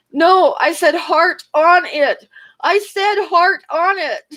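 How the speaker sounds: high, slow, squeaky embarrassed protest; flustered correction. Retold or imitated. Embarrassed coworker correcting his blunder in front of customers, high and flustered. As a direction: plead the correction, red-faced and mortified.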